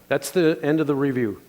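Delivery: ordinary talk, nothing marked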